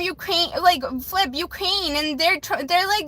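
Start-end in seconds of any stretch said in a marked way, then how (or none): none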